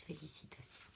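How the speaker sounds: soft whispered "Félicitations"; "Félicitations" barely heard, whispered. Real, not acted. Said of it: "De quoi?".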